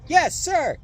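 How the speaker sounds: high, loud panicked recruit shout; shouted reply. A narrator's reading. Frightened recruits answering the sergeant, loud shout. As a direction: yell it back like a scared recruit. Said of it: "everyone except me had to shout".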